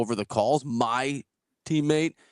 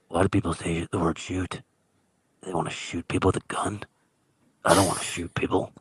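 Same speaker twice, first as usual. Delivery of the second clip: odd drifter voice; 9 semitones lower than usual. Retold or imitated. Man imitates the tennis drifter asking why people say shoot. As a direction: play the creepy stranger puzzling over a word.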